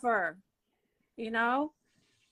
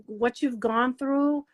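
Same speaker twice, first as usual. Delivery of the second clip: ordinary delivery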